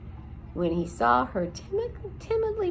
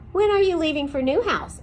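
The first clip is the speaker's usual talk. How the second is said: Aerith's voice; 7 semitones higher, 5 dB louder than usual. A narrator's reading.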